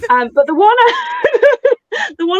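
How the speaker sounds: high, loud, laughing words; losing it to giggles mid-sentence; breaking into laughter. Real, not acted. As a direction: try to continue while cracking up. Cracking up after her own joke.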